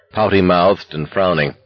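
usual speaking voice

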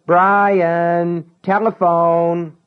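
put-on child voice calling upstairs; high, loud little boy's call. Little brother calling up stairs.

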